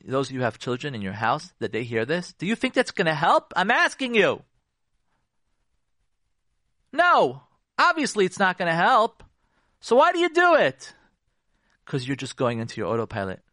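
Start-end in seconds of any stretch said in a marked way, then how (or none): 2.3-10.9 emphatic grilling. Shown as a demonstration. Rhetorical grilling of the audience.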